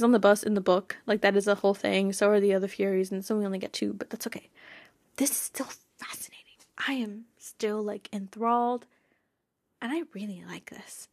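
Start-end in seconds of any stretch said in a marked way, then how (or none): 5.2-11.1 hushed, thrilled whisper. Real, not acted. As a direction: whisper it like a secret you love. Whispering her excitement to viewers.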